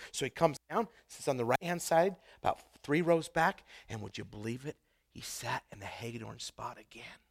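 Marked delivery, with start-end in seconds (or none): 3.9-7.2 whisper. Real, not acted. Punchline of a church-seat story.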